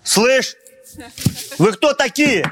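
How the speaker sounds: ordinary talk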